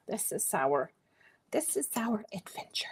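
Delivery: whispered aside; soft conspiratorial whisper. Real, not acted. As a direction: hush it like a secret. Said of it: "whispered aside".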